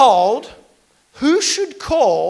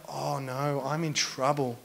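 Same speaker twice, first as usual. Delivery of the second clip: quiet dismay; 10 semitones lower, 14 dB quieter than usual. Retold or imitated. Realising mistake, retold.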